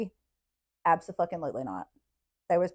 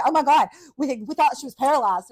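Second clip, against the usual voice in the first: louder, higher stunned exclaiming; shocked staff; 7 semitones higher, 9 dB louder than usual. Retold or imitated. Nurses rushing in, shocked the girl can move, stunned exclaim. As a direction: blurt it out in disbelief.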